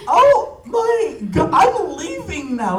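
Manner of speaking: high, loud, drawn-out comic protest; mock exasperated yell. Grandchild storming out after grandmother's jibe, in mock exasperation. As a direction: stretch each word in hammed-up outrage.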